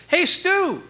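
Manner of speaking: high, bright call across the van. Retold or imitated. Bandmate calling to Stu while loading, high call.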